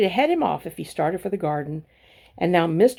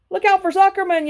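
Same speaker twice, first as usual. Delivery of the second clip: much higher, loud warning yell; gander yelling; 13 semitones higher, 6 dB louder than usual. A narrator's reading. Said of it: "yelled the gander".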